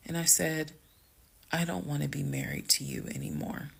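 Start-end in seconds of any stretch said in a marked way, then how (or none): 1.5-3.7 hushed, near-whispered statement; calm. Retold or imitated. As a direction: say it very softly, composed and final. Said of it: "The way that I am talking to you all".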